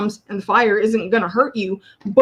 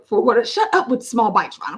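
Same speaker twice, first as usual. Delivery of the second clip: ordinary delivery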